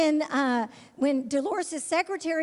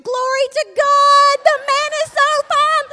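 ecstatic exclaiming; 12 semitones higher, 10 dB louder than usual. Retold or imitated. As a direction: shout it like you just saw the most handsome man alive. Sees future husband, exclaims.

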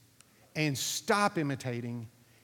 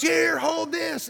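much higher and louder, slow, barked command; retold shouted order; 11 semitones higher, 8 dB louder, slower than usual. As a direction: hand it over urgently, shouting. Instructor during emergency.

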